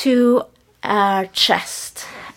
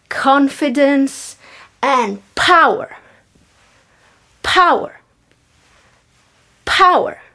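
drama teacher; 2 semitones higher than usual. Acted. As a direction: punch each power like a coach. Instructor barking workout word.

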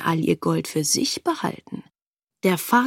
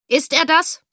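Offizier, hoch und fragend, Stimme steigt auf „das“; fragender Offizier; 8 semitones higher, 4 dB louder than usual. Acted. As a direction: der Offizier fragt scharf nach. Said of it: "Der Offizier deutete". (Offizier zeigt auf den Vater und fragt, steigend)

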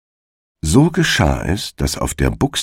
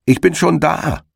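entgegengerufen; 11 semitones higher than usual. A narrator's reading. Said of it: "rief ihm der Herr Igel entgegen".

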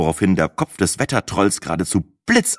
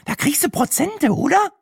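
very high, piping question; comic high-pitched eager voice; squeaky character voice. A narrator's reading. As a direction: squeak it hopefully. Brenda keeps asking, squeaky.

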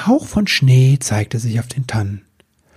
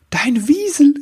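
much higher, breathless burst of delight; a sudden bright idea blurted out; enthusiastic cry; 13 semitones higher than usual. Acted. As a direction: elf thrilled by the weasel. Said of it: "rief er voller Begeisterung".

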